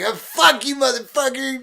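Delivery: put-on loud, high drunk shouting; drunk yelling. Drunk passenger yelling at man outside the cab. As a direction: yell it at the guy outside.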